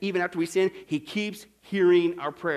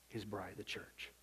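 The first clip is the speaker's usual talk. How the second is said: whispered; 13 semitones lower, 22 dB quieter, slower than usual. Real, not acted. Dropping to a whisper.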